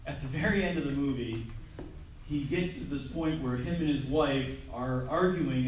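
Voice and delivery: ordinary talk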